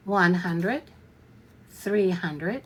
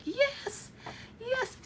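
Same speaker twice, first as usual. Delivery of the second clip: excited yes; 7 dB quieter than usual. Pretend. Imagined buyer thrilled at a sale.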